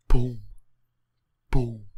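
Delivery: loud punchy boom; announcer boom. Pretend. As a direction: boom like a sportscaster. Sports announcer in roleplay.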